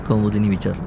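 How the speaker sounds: usual speaking voice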